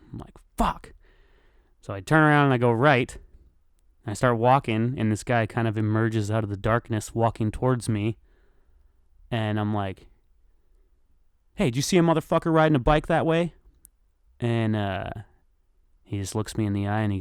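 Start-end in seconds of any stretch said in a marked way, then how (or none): 0.6-0.9 short high-pitched retold curse; mock outburst of frustration; frustrated curse. Biker hears nobody saw the thief, frustrated. 11.6-13.5 higher, fast retold call. Victim asks a passing man about the stolen bike, calling out.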